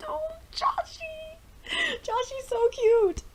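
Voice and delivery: high, slow, tearful-sounding coo; adoring gush. Real, not acted. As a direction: melt over an adorable couple. Gushing over the couple.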